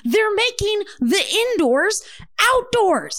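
high-pitched, exasperated mock outburst; apoplectic expert. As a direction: imitate an epidemiologist losing it on air. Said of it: "like, having a stroke on air".

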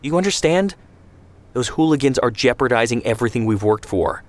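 high, pushed indignant tirade; heated rant. Acted. Ben Shemen berates Zvi about the Etzel, heated. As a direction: hammer him with each point.

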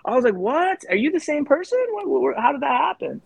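high, stunned disbelief; shocked. Retold or imitated. Retelling being snubbed at the airport, high shocked disbelief. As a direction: gasp it out, totally thrown.

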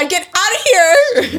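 high, loud mock yell; mock embarrassed. Daughter caught by dad, high mock yell. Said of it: "I would like".

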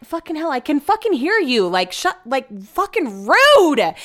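high-pitched, indignant retort, building to a drawn-out peak; retold outrage, swooping up high; exasperated outburst. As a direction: vent it like you're offended and fed up. Fed up at overheard insults.

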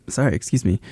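talking through hiccups; words broken by hiccups. Real, not acted. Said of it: "I have the hiccups".

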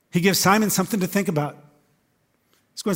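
ordinary talk, nothing marked

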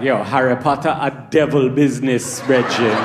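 lightly put-on Jamaican patois, disapproving; a Jamaican dad warning it's devil business; Jamaican accent. Jamaican friend scolding the comic, in a light Jamaican accent. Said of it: "They were like".

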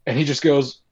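usual speaking voice

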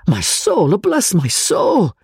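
high, slow, astonished exclamation; bewildered cry. A narrator's reading. The professor cries out, bewildered by his friend's haste. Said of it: "cried the professor, in a state of the extremest bewilderment".

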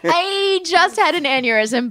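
high, slow, laughing announcement; mock dazed recovery. Real, not acted. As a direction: draw it out high after fumbling. Jokes about her stumble, laughter.

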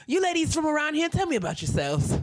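high, quick grand question; imitating Andre. Voicing Andre.

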